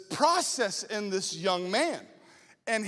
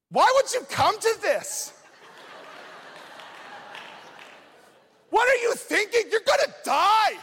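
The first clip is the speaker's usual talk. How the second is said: mocking kid; 11 semitones higher than usual. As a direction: tease him that he'll die. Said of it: "one of the kids is like".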